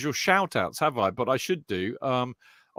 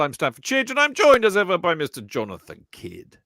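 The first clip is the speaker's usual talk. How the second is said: show-opening announcement; 7 semitones higher, 5 dB louder than usual. Real, not acted. Host welcomes listeners back after the break, big announcer delivery.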